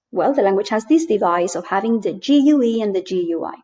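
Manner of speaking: normal talking voice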